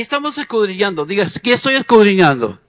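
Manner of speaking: loud, driving preacher's call and response; fiery preaching. Acted. Preacher exhorts the congregation to search the Bible, loud and fiery. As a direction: preach it out at full force.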